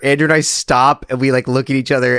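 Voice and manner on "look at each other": ordinary talk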